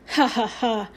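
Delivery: mirthless, spelt-out mock laugh; heavy sarcasm. A narrator's reading. Shopkeeper scoffing at the boy's excuse, heavily sarcastic. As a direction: say each ha heavily, with no amusement. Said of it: "said Mister Yap heavily".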